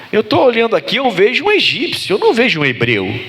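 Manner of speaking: high-pitched, baffled protest; stunned disbelief. Acted. Brothers facing an Egyptian who claims to be Joseph, baffled disbelief. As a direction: act out jaw-dropped brothers who can't make sense of it. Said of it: "o queixo caiu dos irmãos".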